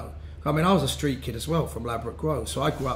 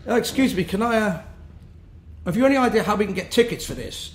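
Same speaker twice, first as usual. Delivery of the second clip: higher-pitched polite guy, a little hesitant; imitated polite student; 6 semitones higher than usual. Guy below asks band for tickets, imitated polite voice. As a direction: voice a polite plea from a stranger, higher.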